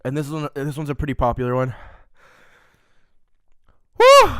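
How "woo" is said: very high loud whoop; pained whoop. Real, not acted. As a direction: let out a high whoop from the burn. Reacting to spice.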